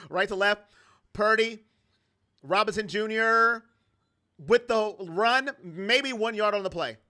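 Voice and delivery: steady game-call delivery; light sportscaster narration; play-by-play call. Acted. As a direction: call the plays like a broadcaster.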